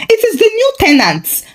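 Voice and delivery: high, fast, defensive retort; women defending themselves quickly to their husbands; defensive answer. A narrator's reading. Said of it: "the women answered defensively".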